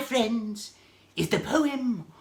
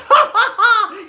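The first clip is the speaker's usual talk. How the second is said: loud laughter; 12 semitones higher, 7 dB louder than usual. Acted. Laughing at own joke.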